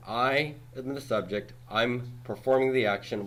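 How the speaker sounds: normal talking voice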